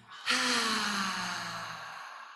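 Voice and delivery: long open-mouth exhale; breathy ha sigh. Shown as a demonstration. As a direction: blow out hot air slowly. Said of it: "like blowing hot air out".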